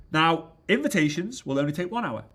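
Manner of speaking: ordinary delivery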